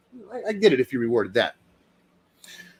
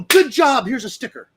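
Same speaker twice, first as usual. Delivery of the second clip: praising parent; 9 semitones higher than usual. Retold or imitated. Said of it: "Do you go".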